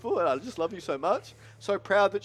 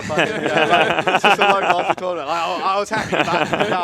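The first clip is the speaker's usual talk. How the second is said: loud, tearful laughter breaking through the words; laughing through tears; 11 dB louder than usual. Real, not acted. Winner laughing off his tears to the crowd, loud laughing through tears. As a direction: laugh it off while still crying.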